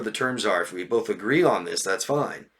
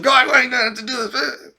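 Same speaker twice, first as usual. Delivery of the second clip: much higher, louder whiny tantrum; mock tantrum; 13 semitones higher, 4 dB louder than usual. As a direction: throw a mock fit. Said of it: "it's a temper tantrum".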